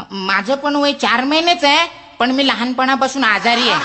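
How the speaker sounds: high, squeaky mouse voice; cocky little mouse. Acted. Mouse asks the elephant its age, high and squeaky. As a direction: size the elephant up. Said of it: "गालातल्या गालात हसला".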